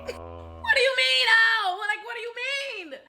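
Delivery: very high, drawn-out squeal of disbelief; hammed-up, high-pitched incredulous question; mock disbelief. Girlfriend questioning his reaction to the test, mock disbelief.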